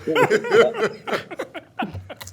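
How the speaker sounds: high, helpless belly laugh; real laughter. Real, not acted. Storyteller losing it over his friend's retort, high laughter. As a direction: laugh it out after the punchline.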